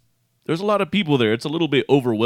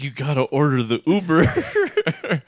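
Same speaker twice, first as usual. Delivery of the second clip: slow, higher, laughing whine; whiny stoned plea; 4 semitones higher, slower than usual. Retold or imitated. To Angel about the Uber, a slow laughing whine. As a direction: drag it out in a silly stoned whine. Frustrated high plea to friend.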